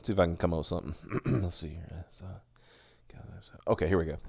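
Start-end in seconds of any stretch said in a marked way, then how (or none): none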